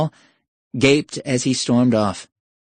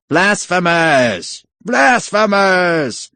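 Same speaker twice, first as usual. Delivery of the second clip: high, drawn-out mock war cry; hammed-up battle cry; 7 semitones higher, 5 dB louder, much slower than usual. Thugs waving daggers at the professor, crying out. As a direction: cry out the accusation slowly and high. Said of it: "waving their daggers and crying".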